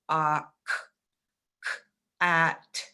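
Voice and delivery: "ah, k, k, at" as separate sounds; sounding out. Shown as a demonstration. Teacher sounding out a word letter by letter. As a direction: sound out each letter slowly. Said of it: "we have to sound out every letter".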